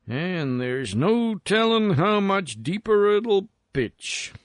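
high, swooping character voice with a mild sigh. A narrator's reading. Miner talking to himself while resting his aching back, sighing. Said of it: "he sighed".

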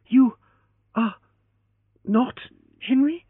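high, halting, breathless disbelief; stunned, rising disbelief; gasped question. Acted. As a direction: gasp it out word by word. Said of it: "she gasped".

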